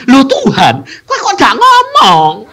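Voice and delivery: high, loud protest; complaining to God. Retold or imitated. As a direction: whine your complaint to God. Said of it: "aku tadi bilang gini". (protes tinggi kepada Tuhan)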